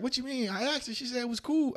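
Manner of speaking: high, whiny mock friend; imitating friend. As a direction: pitch way up like a clueless friend protesting.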